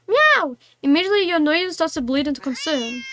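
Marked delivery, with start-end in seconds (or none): 0.1-0.6 high squeaky meow. Pretend. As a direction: mew like a kitten. Voicing the cat.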